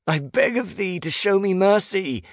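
high, theatrical plea; loud begging. A narrator's reading. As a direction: beg the gods at full voice. Begging the bedroom gods loudly.

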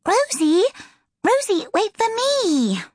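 put-on high, drawn-out child's wail; whimpering. A narrator's reading. Little sister trailing behind calls to her sister, whimpering. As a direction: plead in a high whimper, stretching the words. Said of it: "Now she heard her whimpering".

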